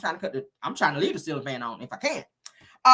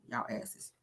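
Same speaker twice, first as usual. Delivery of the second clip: a breathless whisper; whispered aside; 14 dB quieter than usual. Real, not acted. Telling off friends in the chat, whispered. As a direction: hiss it under your breath.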